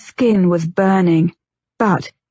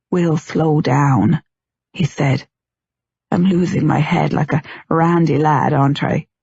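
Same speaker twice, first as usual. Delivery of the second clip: soothing; 4 semitones lower than usual. A narrator's reading. Tom soothing nervous wife.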